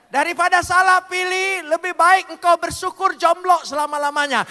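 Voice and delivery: high, loud pulpit proclamation; rousing sermon climax; fiery preaching. Acted. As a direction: preach it out to the congregation. Preacher at full force.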